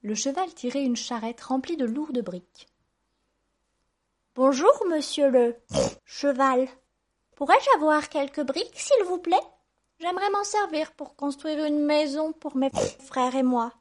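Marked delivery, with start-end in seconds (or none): 4.4-13.7 high, bright-eyed piglet asking nicely, loud; polite little pig. A narrator's reading. Little pig asking the horse for bricks, politely. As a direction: ask courteously in a high piglet voice. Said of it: "demanda poliment le petit cochon".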